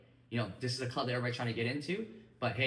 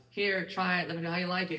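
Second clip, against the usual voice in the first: higher, louder, mock pushy sales offer; pushy pitch; 6 semitones higher, 5 dB louder than usual. The coach mocking a careless product handoff, pushy and loud. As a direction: ham up a bland hard sell.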